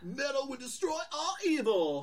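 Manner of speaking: high, loud heroic metal warrior voice; rally the fight like a metal hero; heroic battle cry. Acted. Murder Falcon's battle cry.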